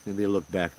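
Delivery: ordinary talk, nothing marked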